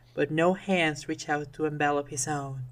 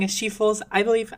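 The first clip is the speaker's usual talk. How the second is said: ordinary delivery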